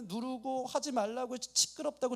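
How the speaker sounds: ordinary talk, nothing marked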